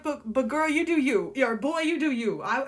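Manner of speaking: usual speaking voice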